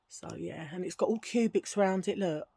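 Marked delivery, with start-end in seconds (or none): none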